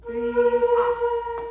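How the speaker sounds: loud, high cutoff call; loud sung-out cue. Real, not acted. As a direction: shout the cutoff over the band. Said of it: "calling cutoff over band".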